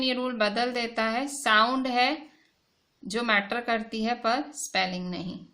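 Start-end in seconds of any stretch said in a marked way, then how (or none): none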